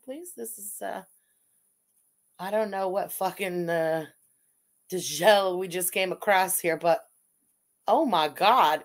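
normal talking voice

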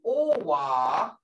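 "owa" slow and drawn out, rising; long vowel demo of "owa". Shown as a demonstration. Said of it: "hear that uh".

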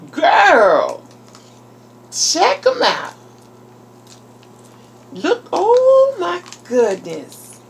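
high, loud, stretched-out gushing; delighted exclaiming. Real, not acted. Vlogger showing viewers her gift, thrilled exclaiming. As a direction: exclaim over them, swooping down.

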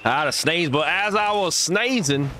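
lively esports play-by-play, rising on big plays; play-by-play casting. Acted. Streamer casting a match to viewers, lively play-by-play. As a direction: call the match like a hyped caster.

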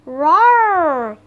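loud, long roar, swelling then falling; gorilla roar. A narrator's reading. Giant gorilla beating his chest at Jack, roaring. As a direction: bellow it like a storybook gorilla. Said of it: "he roared".

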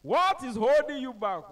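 motivational shout. Retold or imitated. A man urging the crowd on, loud rallying shout. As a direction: hype the crowd up like a pastor. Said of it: "One man was shouting".

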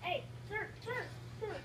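frantic pleading. Pretend. Frantic pleading, rising pitch.

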